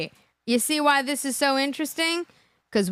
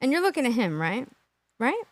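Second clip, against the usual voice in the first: ordinary delivery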